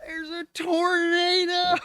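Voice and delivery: high, drawn-out mock scream; hammed-up screaming Boston buddy; Shiloh. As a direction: stretch out the warning in a high imitated panic. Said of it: "he says".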